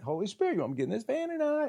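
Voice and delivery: hushed, hurried aside to God; quiet retold check-in with God; quick muttered prayer. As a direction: retell it as a soft, rising question. Retold aside prayer before getting in.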